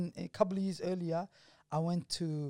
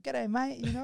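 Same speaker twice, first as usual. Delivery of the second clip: put-on Australian accent; 6 semitones higher than usual. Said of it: "Put on the accent a little bit".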